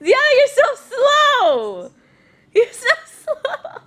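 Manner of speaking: high, slow sing-song teasing. Real, not acted. Baby-talk teasing the child.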